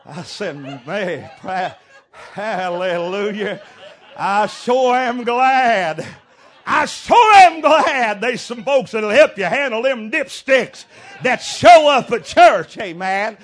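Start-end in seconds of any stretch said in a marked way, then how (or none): 0.9-6.1 laughing through the words; can't hold back the laugh; laughing hallelujah. Retold or imitated. Preacher retelling his joke to the church, laughing. 6.7-12.8 loud, high preacher's cry; fiery preaching. Acted. Preacher rousing his congregation, loud fiery preaching.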